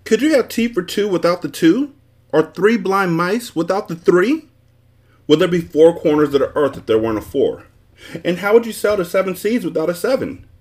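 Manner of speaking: high, indignant tirade; furious. A narrator's reading. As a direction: fire off outraged rhetorical questions. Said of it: "turning red with fury".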